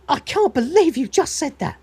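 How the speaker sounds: high, affronted gasp of a line; hammed-up wounded indignation; offended disbelief. Insulted machine reacts to Dave.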